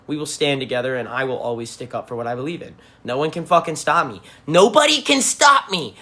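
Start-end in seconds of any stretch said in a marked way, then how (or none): 4.5-6.0 high, loud and slow, each word landed; defiant. Real, not acted. Streamer rallying his fans against the government, slow loud defiance. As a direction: declare it like a rallying cry.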